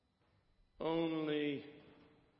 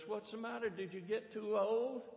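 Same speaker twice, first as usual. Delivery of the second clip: lightly acted, higher-pitched puzzled question; preacher quizzing an old dog, pitched up; mild puzzlement, voice lifted; puzzled questioner; 7 semitones higher than usual.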